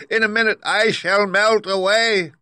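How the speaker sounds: very high, loud wail of alarm; screaming witch. A narrator's reading. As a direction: shriek in panic as you start to melt. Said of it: "she screamed".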